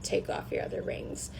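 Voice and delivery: breathy whispered dream quote. Retold or imitated. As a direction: drop to a whisper for the line. Quoting a person in a dream.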